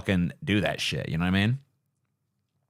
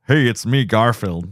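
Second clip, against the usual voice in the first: louder, smiling cartoon cat; smiling Garfield; 5 dB louder than usual. Shown as a demonstration. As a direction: grin through it, upbeat. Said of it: "do like this and smile".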